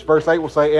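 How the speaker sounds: ordinary talk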